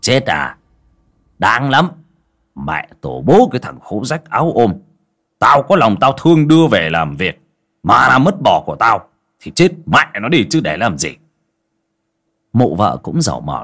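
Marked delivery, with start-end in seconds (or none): 0.0-11.1 louder, scornful master; callous sneer. Acted. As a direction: dismiss the death with contempt. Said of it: "dửng dưng, khinh khỉnh".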